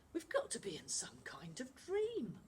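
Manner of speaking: hushed whisper in disbelief; awed whisper. Acted. Amir to the boys, hushed and awed. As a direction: breathe it, stunned.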